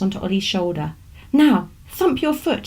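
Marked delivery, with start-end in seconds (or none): 1.3-2.7 commanding. Acted. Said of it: "she commanded".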